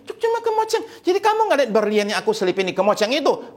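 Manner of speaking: very high, stammering disbelief; stammer, then rush it out high-pitched, aghast; shocked husband. Acted. Husband learning she missed the diamond, shocked. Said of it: "Suaminya kaget dan bilang gini".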